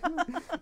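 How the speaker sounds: quick high laugh; high burst of laughter. Real, not acted. Guest laughs at hot spot joke.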